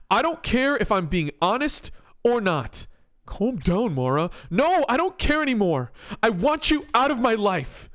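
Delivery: loud furious outburst; angry shouting. Acted. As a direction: yell it at your ex. Acted breakup quarrel.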